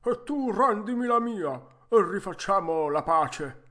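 vecchietto che ribatte forte; replica gridata. Acted. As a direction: parti acuto e forte, poi scendi di tono. Risponde gridando al falegname. (replica a gran voce)

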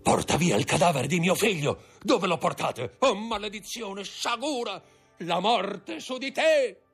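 a father's rage breaking into a shriek; frenzied curse. Acted. Villefort cursing the Count who takes his son, frenzied high curse. Villefort's son's body taken.

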